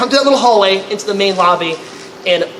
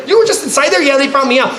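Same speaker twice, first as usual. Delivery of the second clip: mock astonished EMT; shocked recognition; 9 semitones higher than usual. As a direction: react like spotting the suspect. EMT recognizes him, alarmed.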